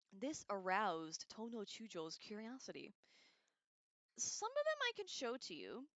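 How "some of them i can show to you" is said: lightly voiced Genji. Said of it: "said Genji".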